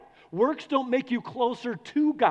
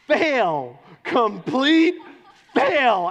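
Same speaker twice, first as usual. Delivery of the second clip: loud, punched verdict, higher on complete; emphatic declaration; 4 semitones higher, 7 dB louder, much slower than usual. Real, not acted. Preacher to congregation, loud emphatic declaration. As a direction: hammer the verdict home.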